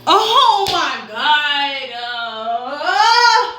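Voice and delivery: drawn-out shocked cry, high and loud. Real, not acted. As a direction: stretch it out in disbelief. Reading positive test.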